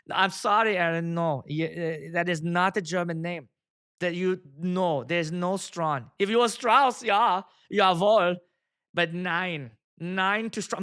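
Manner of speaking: drawn-out mock German accent; emphatic put-on German accent. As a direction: imitate a German correcting a friend. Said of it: "he's got the German accent".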